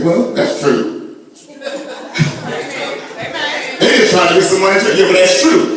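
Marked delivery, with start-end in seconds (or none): 1.6-3.7 breathy laugh. Real, not acted. Laughs at own point.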